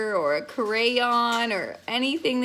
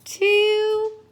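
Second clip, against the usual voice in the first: high sing-song counting; 10 semitones higher, much slower than usual. Shown as a demonstration.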